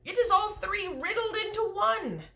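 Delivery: exasperated cry. Acted. Giant correcting Sophie, exasperated high cry. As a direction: explain it in rising exasperation. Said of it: "cried the exasperated B F G".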